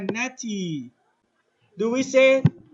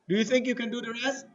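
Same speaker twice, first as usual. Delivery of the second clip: normal talking voice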